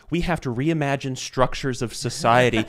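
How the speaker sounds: slow, loud and rising mock rant; mocking zealot rant. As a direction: ham up a fanatic reciting slogans. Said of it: "And they were saying".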